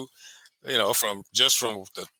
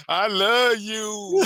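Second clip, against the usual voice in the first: drawn-out high mock declaration; hammed-up, high-pitched pleading; putting on a show; 9 semitones higher, much slower than usual. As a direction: mimic her wailing devotion, stretched out. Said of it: "she's put on a show".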